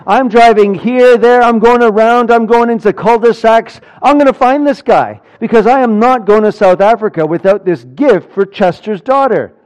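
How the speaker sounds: worked-up preacher reliving a frantic car chase; frantic retelling. Real, not acted. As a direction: tell the chase like you're still behind the wheel. Frantic chase of Amazon driver.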